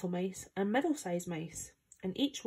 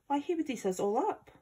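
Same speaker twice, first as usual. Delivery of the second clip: high, protesting exclamation; a small mouse's worried outcry; alarmed cry; 7 semitones higher than usual. A narrator's reading. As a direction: protest in alarm, pitch well up. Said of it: "cried the Queen".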